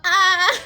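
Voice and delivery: laughing high squeal; excited squeal. Real, not acted. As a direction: squeal with delight. Excited about her progress.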